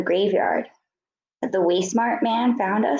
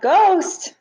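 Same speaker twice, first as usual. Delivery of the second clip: high, loud scared yelp; frightened yelp; 10 semitones higher, 5 dB louder than usual. A narrator's reading. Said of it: "The second voice yelped".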